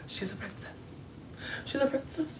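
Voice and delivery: soft, high-pitched murmur; hushed imitation of admiring onlookers; onlookers' hushed awe. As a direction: marvel softly, as if spying a princess. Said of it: "Do you notice that girl?".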